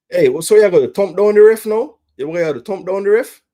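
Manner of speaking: loud, high indignant yell; real angry fan; angry outburst. Real, not acted. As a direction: scold the player for the foul. Angry at player's yellow.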